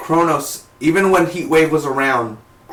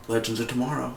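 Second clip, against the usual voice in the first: ordinary talk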